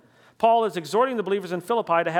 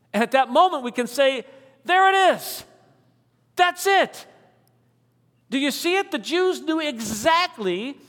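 higher and louder, a breakthrough moment; excited preaching; 7 semitones higher, 4 dB louder than usual. Real, not acted. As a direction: land the reveal with rising fervor. Preacher's climax over the verse.

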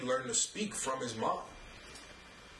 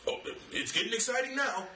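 much higher, mock-excited commentator call; excited announcer; 10 semitones higher than usual. Announcer hyping a punt record to viewers, mock excitement. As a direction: imitate a sportscaster jazzing it up. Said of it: "Jim Nantz got excited".

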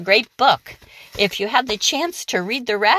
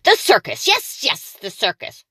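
high thrilled witch; excited witch; 4 semitones higher than usual. Acted. The witch thinking of the circus, high and thrilled. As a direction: seize on the idea. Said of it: "she shouted with a jump".

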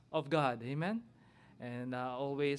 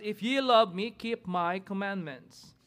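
scripture reading; 4 semitones higher, 3 dB louder than usual. Acted. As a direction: proclaim the verses from the pulpit. Reads scripture aloud, responsive reading.

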